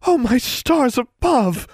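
very high, slow drawn-out swoon; swooning exclamation. Acted. Smitten girl at the news, high swooning exclamation. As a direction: exclaim as if about to faint. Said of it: "Darla exclaimed".

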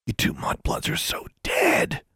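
slow, voiceless menacing hiss; hissed threat. A narrator's reading. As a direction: spit the threat through the teeth. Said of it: "Larry hissed".

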